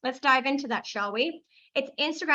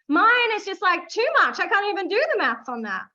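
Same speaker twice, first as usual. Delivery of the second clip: louder, higher, overwhelmed; overwhelmed excitement; 6 semitones higher, 6 dB louder than usual. Real, not acted. Host to her audience, in giddy disbelief. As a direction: burst out at the big number. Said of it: "overwhelmed by the numbers".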